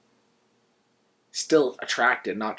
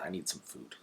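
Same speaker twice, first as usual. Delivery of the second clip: much softer, low muttered request; old owl muttering, far softer and lower; under his breath; 7 semitones lower, 15 dB quieter than usual. Acted. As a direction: mumble it low and barely audible. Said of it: "very quietly under his breath".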